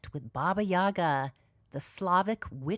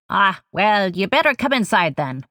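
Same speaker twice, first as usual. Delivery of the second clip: loud, coarse crone calling a visitor inside; coarse, crackling witch shout; 12 dB louder than usual. A narrator's reading. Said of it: "The voice shouts out again".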